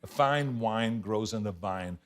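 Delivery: slow, measured drill, W in "wine", V in "vine"; careful diction drill. Shown as a demonstration. Repeating the speech coach's line, "wine" and "vine" carefully distinct. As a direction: keep "wine" and "vine" clearly apart, unhurried. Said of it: "so he was saying".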